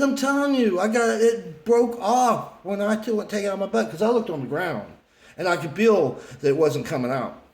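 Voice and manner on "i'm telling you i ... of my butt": insistent raised voice. Retold or imitated. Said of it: "I said".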